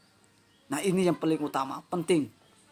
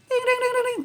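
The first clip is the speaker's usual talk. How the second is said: mock bell ding; faster than usual. Bell signalling time is up.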